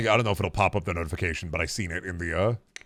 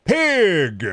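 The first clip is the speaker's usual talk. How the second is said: blurted yell; 10 semitones higher, 6 dB louder than usual. Real, not acted. Blurted word while recalling name.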